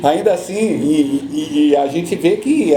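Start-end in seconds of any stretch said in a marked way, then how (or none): none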